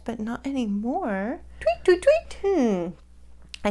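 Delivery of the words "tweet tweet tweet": much higher chirping tweets; bird tweets. Pretend. Host tweets like a bird hidden nearby. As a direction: chirp like a songbird.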